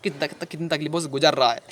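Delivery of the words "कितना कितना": usual speaking voice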